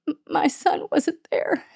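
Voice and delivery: words barely get out through grief; a mother's broken whisper about her son; choked whisper. Real, not acted. Mother recalling son's decline.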